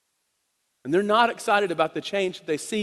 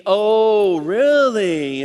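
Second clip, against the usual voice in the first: mock disbelief; 2 semitones higher, 4 dB louder, much slower than usual. Neighbors mocking the healed man.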